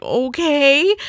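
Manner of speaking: high, shrugging okay; high resigned okay. Retold or imitated. Answering the teacher, a high resigned okay. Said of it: "And I'm like".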